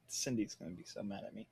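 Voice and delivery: usual speaking voice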